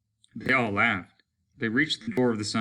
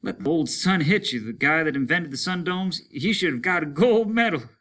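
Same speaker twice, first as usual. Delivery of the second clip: high and loud with a laugh while running; breathless joyful run; panting and happy; 8 semitones higher, 6 dB louder than usual. Acted. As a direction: puff it out grinning. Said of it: "panted Simmons, smiling".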